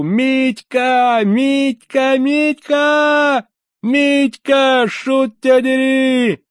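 very high, sustained loud hollering; full-throated yelling. A narrator's reading. Man tearing downstairs after Mitka, full-throated high yell. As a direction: scream the name at the top of your lungs. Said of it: "крича во всю глотку".

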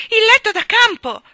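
high, very loud cry; child's startled yell, pitched high; alarmed shout. A narrator's reading. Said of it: "gridò Michael".

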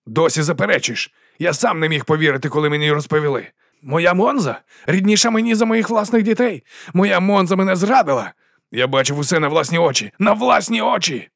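high-pitched, hurt indignation; wounded outrage. A narrator's reading. Duke reproaches his favourite for betrayal, outraged. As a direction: build from reproach to an outraged cry. Герцог кричить про зраду.

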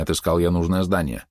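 usual speaking voice

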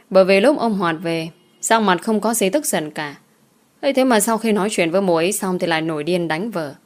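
ordinary delivery

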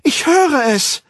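very high, loud, drawn-out shout; high wailing exclamation; ecstatic cry. A narrator's reading. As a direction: call it out in frenzied revelation. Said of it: "rief sie".

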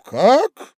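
high, loud yelp of a question; astonished. Acted. Husband hears the rival's name, astonished. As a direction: blurt it out in disbelief.

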